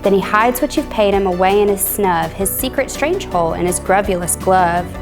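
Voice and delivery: plain reading voice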